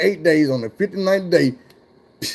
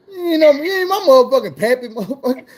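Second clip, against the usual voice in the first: higher, laughing through the insult; laughing disbelief; 9 semitones higher than usual. Retold or imitated. Mocking the driver who calls himself Pappy, laughing. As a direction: crack up mid-line at the name.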